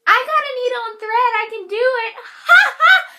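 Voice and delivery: fast, high, loud goofy cackling offer; joking strangers. Retold or imitated. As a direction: ham it up like a rowdy stranger laughing. Passing patients joking about stitching.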